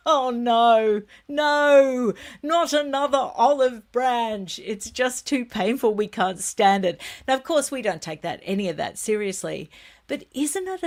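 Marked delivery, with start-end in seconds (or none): 0.1-7.0 loud, drawn-out mock dismay at the start; mock anguish. Vlogger mimicking pained royal watchers, mock anguish. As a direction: wail in playful protest, high and loud. Mock reaction to olive branch news.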